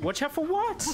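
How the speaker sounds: high-pitched exasperated question; bewildered insistence. Real, not acted. Confused, repeats question insistently.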